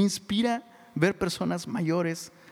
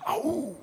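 short high mock Spartan "aroo"; Spartan war cry; 8 semitones higher, 4 dB quieter than usual. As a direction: bark a playful Spartan battle shout. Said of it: "como espartanos".

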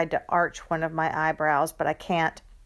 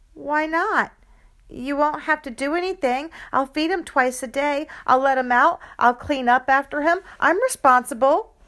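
put-on high, louder pleading kid; eager pleading; 8 semitones higher, 5 dB louder than usual. Child pleading with mom for a dog, eager and high. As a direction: beg mom, piling up promises.

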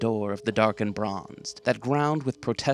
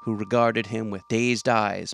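ordinary delivery

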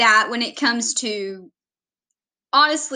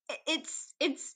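ordinary delivery

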